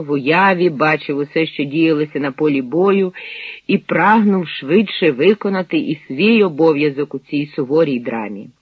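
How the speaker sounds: plain reading voice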